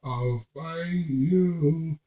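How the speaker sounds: retelling a TV death scene, mock menacing; hammed-up dying threat. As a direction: stretch it out like a dying man swearing revenge. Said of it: "And he's going".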